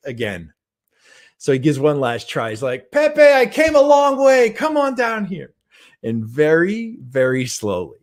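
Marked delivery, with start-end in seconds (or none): 2.9-5.5 pleading call. Retold or imitated. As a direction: coax the lion down from far away. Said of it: "he yells up to Pepe".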